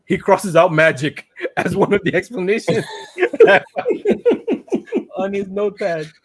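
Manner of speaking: cracking up mid-sentence; laughing while talking. Real, not acted. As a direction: tell it through real laughter. Tickled by the recapped detail.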